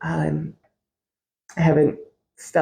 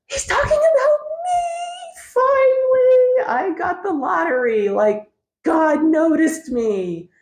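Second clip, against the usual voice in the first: much higher, squealing, hammed-up glee; mock thrilled past self. Mocking her younger self picked out in church: hammed-up squealing delight. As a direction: gush like you just won the lottery. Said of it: "I would have been like".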